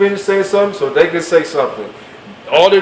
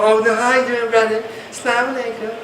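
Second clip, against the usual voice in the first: sweetened voice; 4 semitones higher than usual. Retold or imitated. Woman sweetly greets brother on phone.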